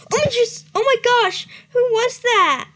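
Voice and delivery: high, loud hammed-up gasp; mock startled. Starpaw wakes to a voice, hammed-up startled squeak. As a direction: play the kitten jolted awake. Acting startled kitten line.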